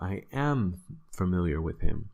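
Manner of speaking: AM stressed and held before the rest. Shown as a demonstration. Teacher showing emphasis on the verb, stress on AM. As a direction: put the weight on "am". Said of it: "you kind of emphasize that verb".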